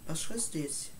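usual speaking voice

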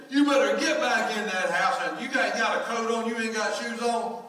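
loud, high scolding; mock stern big-brother shout; scolding son. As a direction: yell at the child to get inside. Son scolding little girl.

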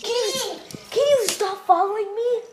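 high, whining complaint; whiny high plea. Real, not acted. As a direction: whine it up high. Annoyed at sibling following.

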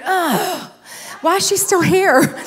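high, groaning complaint; mock exasperation. Speaker grumbles that the earlier preacher hasn't left, mock exasperated. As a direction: play up the dismay, pitched high.

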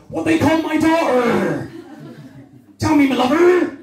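high, broad West Country dad, half laughing; an excited Devon father demanding answers; Devon dad accent. Retold or imitated. A Devon dad at the wedding presses his wife, high and broad. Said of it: "he's like".

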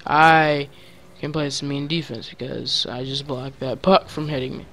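normal talking voice

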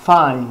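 fine said in one syllable, fain; single clipped syllable on fine; fine as one beat. Shown as a demonstration. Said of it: "há apenas uma sílaba na palavra fine".

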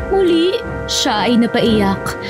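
ordinary delivery

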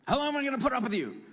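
fast, high, fed-up parent scolding; the exasperated parent that every parent in the room has been. Retold or imitated. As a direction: blurt it like a parent at the end of their rope. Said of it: "how many of you are parents have ever said".

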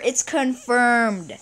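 "confirmed" stretched very slowly; drawn-out announcement. Real, not acted. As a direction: savour each syllable of confirmed. Celebrating lucky day.

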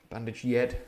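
"yed" said with a y sound, not h; head spoken as "yed"; northern yed. Shown as a demonstration. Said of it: "I pronounced head as head".

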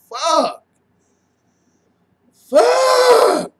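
high, loud angry shout; frustrated outburst. Real, not acted. Fan cursing a holding penalty with a loud shout. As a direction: blurt it out in frustration.

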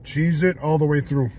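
chewing while speaking; muffled words between bites; mouth full of pizza. Real, not acted. Seen 0: Holds pizza slice, then lips pursed and chewing while looking down.

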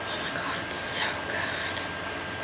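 breathless whisper of delight; gushing whisper. Real, not acted. Fan gushing to viewers about her favorite song, in a whisper. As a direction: whisper it like it's too good to say aloud. Gushing over Sarah's theme.

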